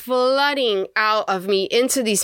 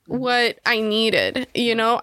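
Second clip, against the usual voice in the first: ordinary talk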